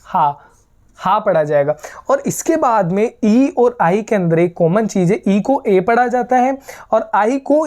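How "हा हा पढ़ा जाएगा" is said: हा said as a breathy open ha; teacher demo of German H as हा; German H sound. Shown as a demonstration. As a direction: say हा with a clear puff of breath. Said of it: "एच को".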